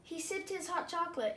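ordinary delivery, nothing marked

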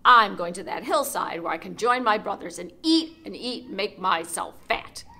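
booming big goat voice; loud big goat. Acted. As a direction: stand your ground loudly. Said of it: "just as loud as the troll's".

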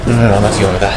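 loud, rattled string of no's; panicked refusal. Pretend. Refusing to go on after the scare, loud and rattled. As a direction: blurt it out, wanting out at once.

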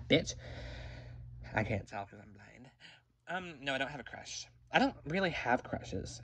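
ordinary talk

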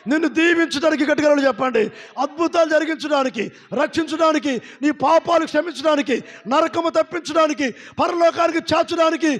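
fiery call and response preaching; preaching at full force. Real, not acted. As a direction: build the congregation up, line by line.

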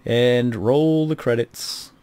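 slow, grand announcer cue; stretched-out showman's flourish; mock announcer. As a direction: call it like closing a film.

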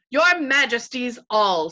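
lightly voiced proud knight, drawn out; slow, stately call for attention; Reepicheep. A narrator's reading. Gallant warrior addressing the royal company, proudly.

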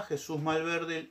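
ordinary delivery

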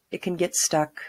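ordinary talk, nothing marked

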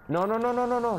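high frantic string of noes; panicking as the enemy closes in; panicked no. Real, not acted. Panicked denial in a chase.

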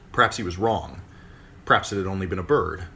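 usual speaking voice

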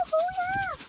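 high excited woohoo; excited whoop. Real, not acted. Cheering as washing starts.

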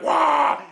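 loud whooshing flush noise; imitated toilet flush. Comic flushing to cover the noise, imitated flush. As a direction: make a loud roaring flush sound. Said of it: "You flush to make all the noise".

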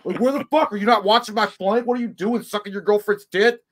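high, loud, raging gamer yell; mock gamer rage. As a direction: scream at your useless teammate, hammed up.

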